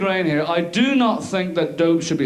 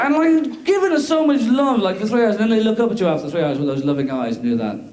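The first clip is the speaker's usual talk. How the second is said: usual speaking voice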